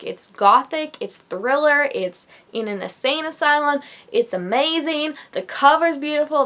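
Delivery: normal talking voice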